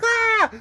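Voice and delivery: girlfriend's celebratory scream, imitated high; high-pitched scream. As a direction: squeal it like she did. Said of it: "it's a high-pitched scream".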